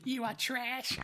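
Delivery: high, mocking catty voice; mocking demon gossip. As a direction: jeer like a spiteful gossip, pitched high.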